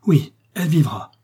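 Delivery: a loud joyful outcry; crying out. A narrator's reading. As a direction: burst out with fierce joy. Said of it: "s'écriant".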